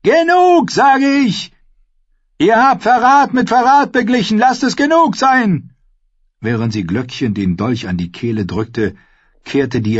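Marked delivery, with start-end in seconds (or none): 0.0-5.8 high, loud demand; desperate shout. A narrator's reading. Mother demands an end to betrayal, shouting. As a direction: cry out to end it. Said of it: "rief Caitlin".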